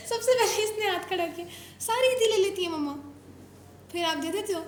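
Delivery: usual speaking voice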